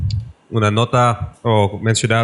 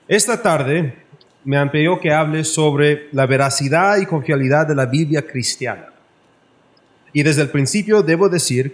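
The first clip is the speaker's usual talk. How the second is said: formal oratory; 5 semitones higher than usual. Acted. Speaker opening the debate to the audience, in raised formal oratory. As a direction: proclaim it from the pulpit.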